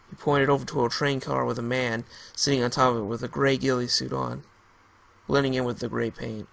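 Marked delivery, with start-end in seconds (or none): none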